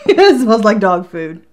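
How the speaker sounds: loud laughing delivery. Real, not acted. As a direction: blurt it loudly through laughter. Still laughing at the smell.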